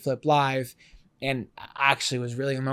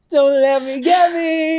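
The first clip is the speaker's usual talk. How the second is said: mock crowd belting; 11 semitones higher, 8 dB louder, slower than usual. Crowd screaming the lyric.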